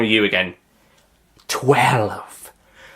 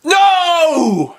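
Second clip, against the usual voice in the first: anguished shout; 7 dB louder than usual. Acted. Master defeated.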